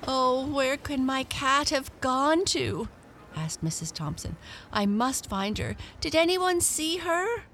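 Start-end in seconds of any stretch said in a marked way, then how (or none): 0.1-2.9 high-pitched fretful woman's voice; worried cat owner. A narrator's reading. Owner voiced searching for lost cat. 4.7-7.4 high, urgent appeal rising higher; worried cat owner. A narrator's reading. Owner voiced asking bystanders for help.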